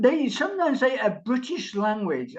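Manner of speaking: ordinary talk, nothing marked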